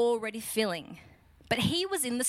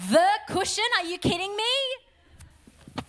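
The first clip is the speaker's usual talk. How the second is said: high, drawn-out mock disbelief; incredulous outrage; 9 semitones higher, 5 dB louder than usual. Millennial finding Jesus asleep on the cushion, incredulous outrage. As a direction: hit it high, aghast and disbelieving.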